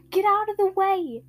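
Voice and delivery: urgent shout. Acted. Zenitsu warns mid-battle.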